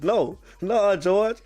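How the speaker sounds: won't let him off the hook, voice climbing; worked-up protest. Real, not acted. Host scolds caller George about defense.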